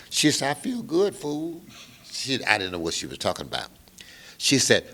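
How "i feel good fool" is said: high, sassy woman's voice, dropping off on fool; dancer's sassy reply. Retold or imitated. Dancer to Bobby, high teasing woman's voice. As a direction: play the cheeky dancer, much higher.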